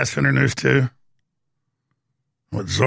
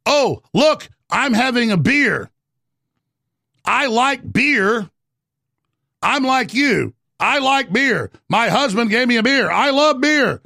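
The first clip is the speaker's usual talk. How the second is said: higher, sing-song fake-folksy voice; mocking Elizabeth Warren; 7 semitones higher, slower than usual. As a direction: ham up a politician pretending to be relatable. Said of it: "Elizabeth Warren".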